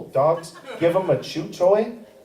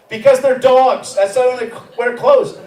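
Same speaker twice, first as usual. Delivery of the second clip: louder and higher, driving the point home; emphatic punchline; 7 semitones higher, 7 dB louder than usual. Real, not acted. Comic to the crowd, making a point: louder, higher punchline.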